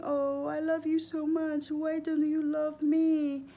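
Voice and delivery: high, whiny guilt-trip plea; mock crying parent. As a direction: ham up a parent begging through fake tears. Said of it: "if they're crying, saying".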